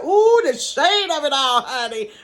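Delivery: high, loud sassy gasp; a kid delighting in her mother's shady gossip. Retold or imitated. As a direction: savor the gossip. Child reacting to gossip.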